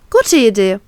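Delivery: light up as you say it; beaming. A narrator's reading. Woman beams at him in the bath. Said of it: "strahle ich ihn an".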